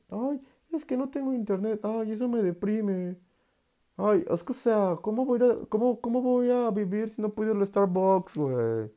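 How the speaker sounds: voz aguda de niño mimado quejándose; queja mimada. Retold or imitated. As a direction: imitando a un consentido que lloriquea. Imita a alguien deprimido por nimiedades. (imitando a un mimado sin internet)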